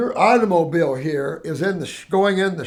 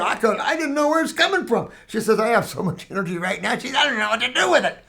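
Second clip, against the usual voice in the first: excited client; 5 semitones higher, faster than usual. Retold or imitated.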